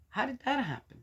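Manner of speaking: a light hint of bewilderment; puzzled. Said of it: "I just sometimes go".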